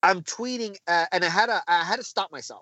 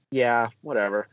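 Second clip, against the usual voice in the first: low, deflated retort; sulky, mock-resigned dismissal; dismissive brush-off; 7 semitones lower than usual. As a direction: brush it off, fed up. Retelling a sulky brush-off to wife.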